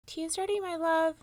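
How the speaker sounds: high sing-song call; sweet wife. A narrator's reading. Una calling Finn to tea, sing-song.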